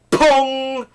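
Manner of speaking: high, ringing imitated ricochet; long high-pitched comic ping; bullet sound effect. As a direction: sing out the bullet's impact, high. Imitating bullet stopped by fingers.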